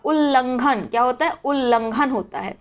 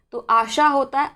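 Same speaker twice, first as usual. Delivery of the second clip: usual speaking voice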